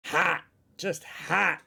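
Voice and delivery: ordinary delivery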